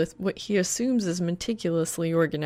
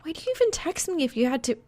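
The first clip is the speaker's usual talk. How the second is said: put-on quick worried girl; 10 semitones higher, faster than usual. A narrator's reading. Reaper upset, fast, high.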